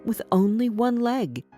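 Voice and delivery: plain reading voice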